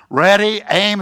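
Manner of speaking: commander's order. Acted. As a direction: call out the firing-squad order, playfully. Commander ordering a firing squad.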